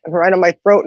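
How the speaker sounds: normal talking voice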